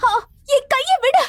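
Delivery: high, loud pained cry. Acted. Woman being grabbed cries to be let go. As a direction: wrench free, crying out in pain.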